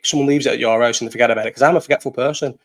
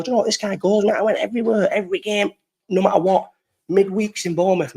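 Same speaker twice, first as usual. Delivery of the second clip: lightly voiced fans, higher pitch; imitating won-over fans; 7 semitones higher than usual. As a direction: mimic fans giving grudging credit, pitched up. Voices fans who came round to her.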